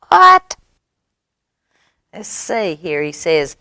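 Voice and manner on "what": high, loud what; acted astonishment. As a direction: yelp it in mock disbelief. Animal reacts to absurd claim.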